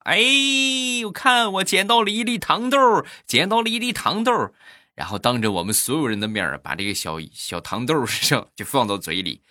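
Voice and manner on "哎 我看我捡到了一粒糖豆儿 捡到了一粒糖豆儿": put-on high, sing-out child's boast; gleeful showing off. Boy showing friends his candy find, high gleeful boasting. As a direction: imitate a delighted kid crowing over a find. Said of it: "很开心的跟我们就炫耀".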